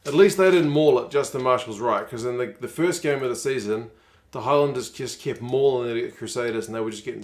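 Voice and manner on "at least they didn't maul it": relieved outburst. Real, not acted.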